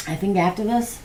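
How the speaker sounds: talking through a hiccup. Real, not acted. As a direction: cut off by a hiccup. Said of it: "hiccup, sorry".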